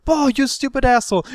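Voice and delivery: high-pitched mock screaming at the narrator; screaming girls. Girls scream at him after he punched their friend. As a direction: shriek it like the furious girlfriends. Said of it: "they were screaming at me".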